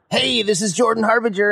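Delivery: high, pumped-up greeting; hyped phone greeting. Shown as a demonstration. Host showing the overhyped way to answer the phone, pumped up. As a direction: answer already at eleven. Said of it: "you could always answer the phone like".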